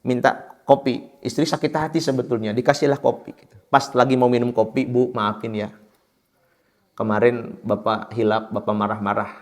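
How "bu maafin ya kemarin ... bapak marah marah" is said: much softer, meek husband begging forgiveness; husband apologising softly to his wife after yelling; sheepish apology. Retold or imitated. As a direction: lower and hushed, a contrite husband. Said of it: "kata suami kepada istrinya".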